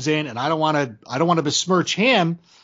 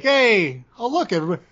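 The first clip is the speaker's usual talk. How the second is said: higher, a lightly voiced showman greeting; Michael Scott bit; 6 semitones higher than usual. Mock announcing a character to his co-host, higher and lively. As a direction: hail the room like a goofy boss. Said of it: "a character that Michael Scott would have brought out".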